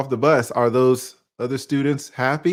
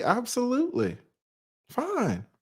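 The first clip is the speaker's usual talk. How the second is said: much higher, softer mock reply; imitating people; 11 semitones higher, 6 dB quieter than usual. Vlogger lightly mimicking people answering about racism. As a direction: lightly mimic a knowing New Yorker. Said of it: "A lot of people would say".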